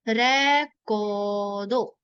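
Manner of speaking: रेकॉर्डो stretched very slowly, long को; slow demo. Shown as a demonstration. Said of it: "katakana में रेकॉर्डो". (रेकॉर्डो में को लंबा)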